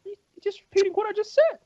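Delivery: high, whining, teary complaint; hammed-up crybaby protest; mock whine. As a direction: whine like a hurt kid. Teasing a guest.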